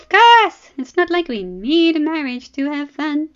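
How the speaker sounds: mocking princess. Arianne mocking Dany.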